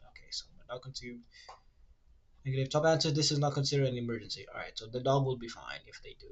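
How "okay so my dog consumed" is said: fast whispered read-out; half-reading under the breath. Real, not acted. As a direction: mumble it to yourself in a whisper. Reading search result under breath.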